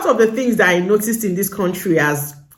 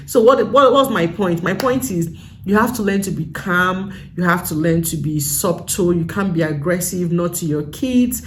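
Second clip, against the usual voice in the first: ordinary talk, nothing marked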